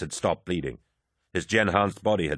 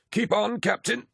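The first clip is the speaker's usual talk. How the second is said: much higher, strained through pain; pained drawl. A narrator's reading. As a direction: urge someone on while hurting. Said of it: "Kyrus drawled, in pain".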